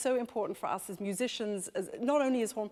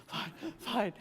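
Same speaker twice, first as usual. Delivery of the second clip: mock out of breath. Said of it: "Bit out of breath".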